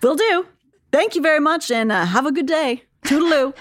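high, bright, overly cheerful reply; sing-song eager obedience; chirpy compliance. Retold or imitated.

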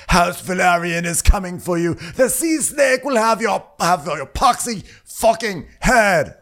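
high, loud, drawn-out battle cry; really angry. Acted. Tied-up captive threatening the crab feeder, furious mock yell. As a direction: bellow a slow, hammed-up threat. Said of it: "he's really angry. And he's like".

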